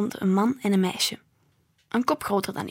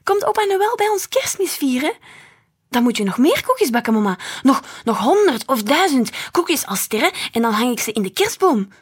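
put-on excited child's voice, raised and loud; cheering; 5 semitones higher, 6 dB louder than usual. A narrator's reading. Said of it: "juichte Noor". (juichend, opgewonden kind)

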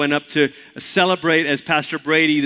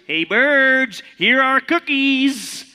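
high, slow calling shout; 8 semitones higher, much slower than usual. Acted. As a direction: call out to the birds. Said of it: "he shouted in a loud voice".

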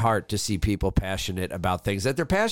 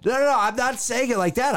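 high flustered protest; 11 semitones higher than usual. Real, not acted. Protesting accusation of disclaimer.

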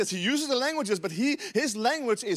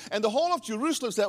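ordinary delivery

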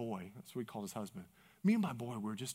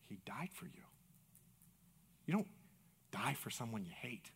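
very soft, low and sincere; hushed reassurance; 7 semitones lower, 16 dB quieter than usual. Retold or imitated. Pastor consoling a hurting man, hushed reassurance. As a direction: drop low and reassure him almost in a whisper.

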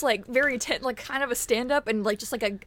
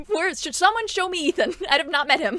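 laughing; 6 semitones higher than usual. Acted. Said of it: "I'm just shouting".

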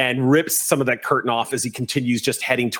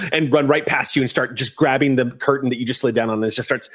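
ordinary delivery

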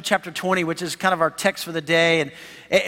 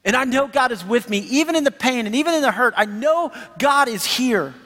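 higher, earnest plea of faith; pleading Job; 6 semitones higher than usual. Retold or imitated. Suffering Job answering his accusing friends, higher and pleading. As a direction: cry out your trust, raised and pleading. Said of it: "Job's just like".

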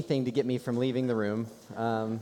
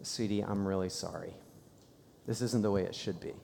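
soft, much quieter apology to a daughter; comforting a crying daughter, soft and sorry; tender apology; 5 semitones lower, 6 dB quieter than usual. Retold or imitated. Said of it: "all I could really come to say".

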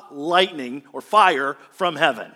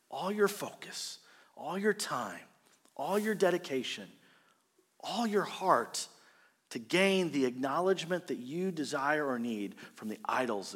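soft earnest preaching; 3 semitones lower, 9 dB quieter than usual. Real, not acted. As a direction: draw them in quietly. Quiet solemn preaching stretch.

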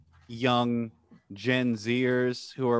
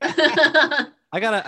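teasing; 13 semitones higher, 8 dB louder, much slower than usual. Real, not acted. Teasing surprise announcement.

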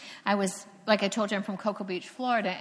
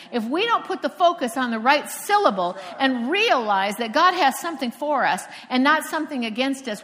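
ordinary talk, nothing marked

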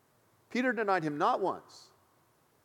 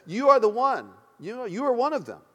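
louder, higher accusing voice; single him out insistently, pitched up; pointed accusation; 5 semitones higher, 6 dB louder than usual. Acted. Bystander accusing Peter by the fire, pointed accusation.

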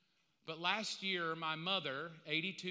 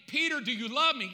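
loud, raised-pitch retold question; urgent questioning; 7 semitones higher, 12 dB louder than usual. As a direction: call it out as Jesus challenging Peter. Jesus questioning Peter on the bank.

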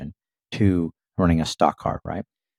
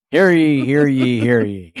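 raised, proclaiming crier's call; town crier; 4 semitones higher, slower than usual. Pretend. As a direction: cry it out like a herald in the square. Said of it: "I'm ringing the bell".

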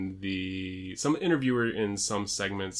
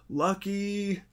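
high-pitched imitated exclamation; imitating Byron Saxton; 12 semitones higher than usual. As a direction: blurt it out much higher, as a quote. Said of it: "Byron Saxton just goes".

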